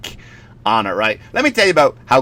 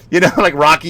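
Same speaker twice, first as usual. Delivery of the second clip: ordinary talk, nothing marked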